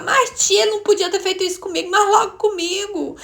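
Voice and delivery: high, aggrieved retold protest; playing the victim. Niece protesting to her aunt about being singled out, wounded and high. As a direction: plead indignantly, voice pitched right up. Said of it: "fiquei com aquele papel de vítima".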